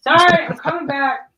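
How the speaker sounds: loud apology on returning; loud call back. Real, not acted. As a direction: shout it back to the room. Returning to stream, calling out.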